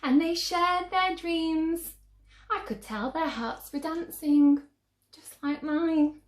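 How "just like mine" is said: ordinary delivery, nothing marked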